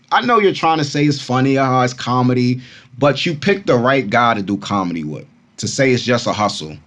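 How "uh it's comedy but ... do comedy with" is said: usual speaking voice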